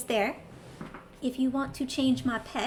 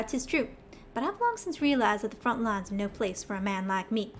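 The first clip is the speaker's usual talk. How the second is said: put-on lightly drawled Southern accent read in for a Cockney character; a Southern accent standing in for Cockney, held lightly; Southern twang. Streamer voicing the bitter old schemer Glommet, Southern accent. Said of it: "the heaviest Southern twang that I can pull off".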